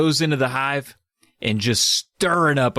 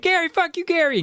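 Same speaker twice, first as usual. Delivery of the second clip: much higher squeal of insult; mock squeaky scream. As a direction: shriek it like a tiny bee. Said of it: "He screams at Gary".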